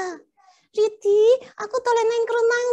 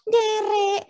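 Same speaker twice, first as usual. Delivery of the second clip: slow polite Javanese; much slower than usual. Acted. Puppet answers in polite Javanese.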